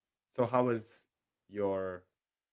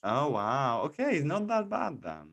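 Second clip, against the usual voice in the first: much higher, bright wow; impressed surprise; 7 semitones higher, 6 dB louder, slower than usual. Real, not acted. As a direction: react as if pleasantly startled. Reacting to news of vaccine dose.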